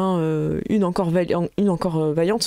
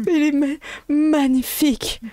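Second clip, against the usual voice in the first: mad doctor; 7 semitones higher, slower than usual. Acted. Doctor admires his creation.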